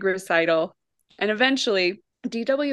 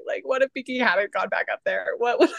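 ordinary delivery

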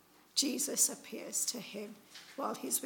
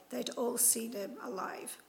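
ordinary talk

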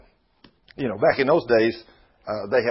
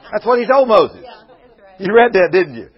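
shouted preaching, louder; preaching hard; 6 semitones higher, 10 dB louder than usual. Real, not acted. Preacher pressing the congregation, loud. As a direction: hammer the point to the church. Preacher driving the point home.

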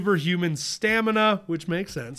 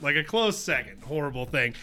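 ordinary delivery

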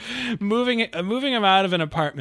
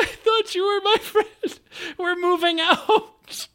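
laughing explanation; 13 semitones higher, 3 dB louder than usual. Retold or imitated. Explaining to startled stranger.

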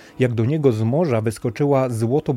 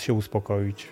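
plain reading voice

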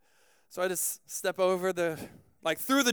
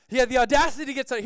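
fast, raised, louder outrage; comic indignant outburst, higher and louder; mock indignation; 4 semitones higher, 5 dB louder, much faster than usual. Real, not acted. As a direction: repeat it faster, as if scandalised.

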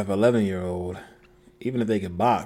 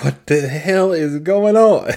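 louder, slow, baffled outrage; a parent in the crowd, slow and loud in disbelief; mock outraged bystander; 5 semitones higher, 7 dB louder, slower than usual. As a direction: play the stunned onlooker demanding answers. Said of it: "everyone's like".

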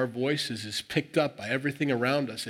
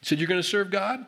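higher-pitched, skeptical prodding; challenging; 5 semitones higher than usual. Retold or imitated. Joshua testing the people's promise, challenging. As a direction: lean in and give them a hard time. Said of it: "he's kinda giving them a hard time".

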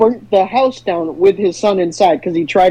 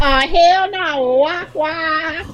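sound effect voice; 5 semitones higher, much slower than usual. Acted. Sound guy meme sound effect.

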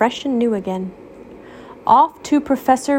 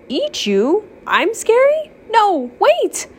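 shocked Waffle; 12 semitones higher than usual. Acted. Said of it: "Waffle uttered".

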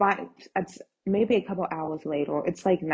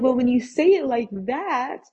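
higher, louder exclamation, stressing THAT; alarmed concession; 6 semitones higher, 5 dB louder than usual. Retold or imitated. Conceding to dad's psychopath warning, comically alarmed. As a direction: give in with mock alarm on "say it like that".

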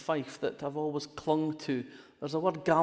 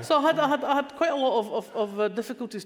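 laughing; 9 semitones higher, 6 dB louder than usual. Real, not acted. Still laughing after the story.

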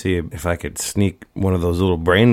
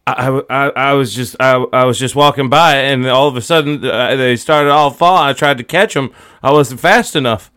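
quick, stammering, raised-pitch excuse; flustered excuse; 4 semitones higher, 4 dB louder than usual. Acted. Caught boy explaining the fallen crate to Doctor Paul, flustered stammering. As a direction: stumble over a hurried alibi.

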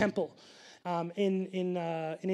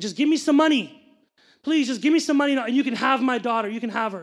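louder, higher retold begging, easing at the end; desperate pleading; 3 semitones higher, 6 dB louder than usual. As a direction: imitate a man begging urgently for money. Man begging to sell his baby.

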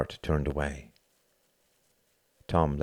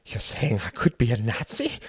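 straining for indignation, slightly raised pitch; false outrage; 4 semitones higher than usual. A narrator's reading. Accused friend answering Tom, feigning outrage. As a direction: act offended without quite managing it. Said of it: "voice strove for false outrage".

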